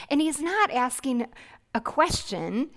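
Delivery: normal talking voice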